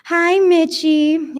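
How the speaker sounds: high, drawn-out sing-song hello; greeting in unison. A narrator's reading. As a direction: chime it out loudly like two women together. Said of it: "Adriana and Tammy say in unison".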